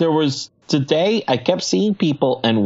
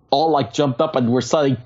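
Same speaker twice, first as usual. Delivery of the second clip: ordinary talk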